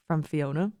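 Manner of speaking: ordinary delivery, nothing marked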